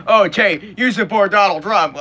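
mock the naysayers in a put-on voice; mocking critic. Vlogger mimicking a critic commenting on him, mildly mocking. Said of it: "I know I'm gonna get a dollar".

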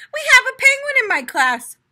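high, loud and fast; excited children. A narrator's reading. Children telling their teacher, high fast excitement. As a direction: blurt out the news. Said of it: "cried the children".

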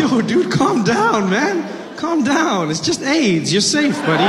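lightly high, mocking reassurance; mock soothing. [name] teasing the scared passenger, mock calming him down. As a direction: tease him while pretending to calm him. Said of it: "I was like".

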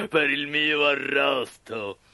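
big ogre voice, gloating; menacing puppeteer. Acted. Puppeteer calling for firewood for his roast, menacing and gloating. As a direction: order it with wicked relish. Mangiafuoco figure.

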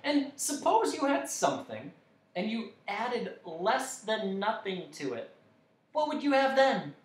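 high, eager lecturing voice; explaining dodecahedron. A narrator's reading. Dodecahedron teaching Milo arithmetic, high and keen. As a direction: reason it out brightly in a high voice.

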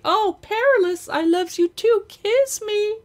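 high, loud lovestruck squeal; mock swooning girlfriend. [name] imitating Peaches to tease Perry, high mock swoon. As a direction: ham up a swooning sweetheart. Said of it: "You just want her to say".

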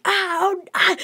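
high, loud imitated yelling; loud hammed-up cries of alarm; mock yell. Co-host imitates Monk yelling at spit buckets, mock yell. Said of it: "He's just yelling".

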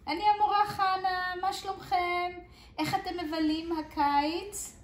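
put-on high, lively talk to little kids; bright teacher voice. Teacher Hana greets children on summer break, high and lively. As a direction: chat up to the children like a kindergarten teacher.

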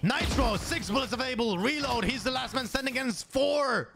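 keyed-up shoutcaster commentary; live play-by-play. Real, not acted. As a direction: call each beat of the fight as it happens.

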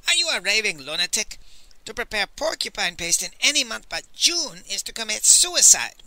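exasperated outburst; angry yell. A narrator's reading. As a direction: yell at him as if he were mad. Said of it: "Master Li yelled".

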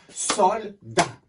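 "Soldat" split into two separate beats; syllable by syllable. Shown as a demonstration. Teacher shows pupils how to tap a word, syllable by syllable. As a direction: clap out "Soldat" with a gap between Sol and dat. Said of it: "On va le taper".

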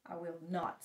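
low, soft grumble; grumpy refusal. A narrator's reading. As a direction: refuse from under the covers, low and quiet. Sleepy Toad refuses Frog's call.

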